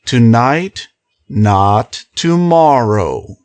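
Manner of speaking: slow, deliberate and low; very slowly. A narrator's reading. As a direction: spell it out slowly. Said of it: "he said very slowly".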